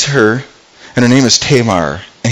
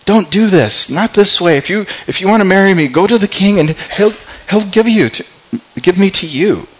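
retold plea of a frightened sister; pleading; 8 semitones higher than usual. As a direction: beg him to stop, voice climbing high. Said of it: "Tamar's, like, going".

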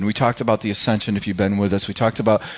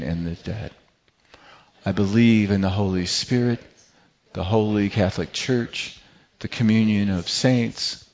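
creed recitation; 3 semitones lower, 4 dB quieter than usual. Acted.

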